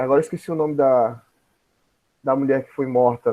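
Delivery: normal talking voice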